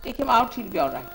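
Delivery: normal talking voice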